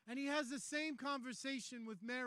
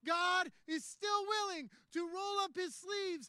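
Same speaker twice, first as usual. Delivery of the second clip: higher, louder, emphatic pulpit delivery; rousing preaching climax; 6 semitones higher, 5 dB louder than usual. Acted. Preacher promising God will do the heavy lifting, rousing and forceful. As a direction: drive it home to the whole room.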